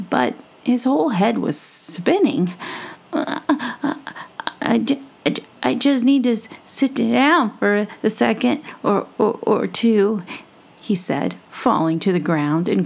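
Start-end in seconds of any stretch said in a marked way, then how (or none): 3.1-10.2 stammering and breathless; dizzy stammering mouse. Acted. Dizzy mouse after the ride, stammering. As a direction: stumble over words, head spinning. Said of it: "tried to sound tough, head spinning".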